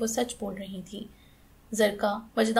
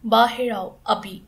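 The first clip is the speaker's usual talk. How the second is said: loud, slow, furious command; thundering order; 5 dB louder, slower than usual. Acted. Aunt ordering her nephew out of the room, thundering. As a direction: bellow it out, each word heavy. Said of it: "उनकी धाड़ इस कदर ऊंची थी".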